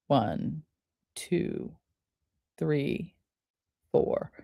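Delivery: breathing coach counting under the breath; soft slow count. Shown as a demonstration. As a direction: count the breaths quietly and slowly. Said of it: "reminds you to do".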